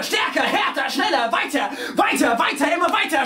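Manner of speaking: high, driving cries; shouted urging. A narrator's reading. One of the riders driving the horde on, shouted urging. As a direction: urge the horde onward in a shout. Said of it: "schreit da einer".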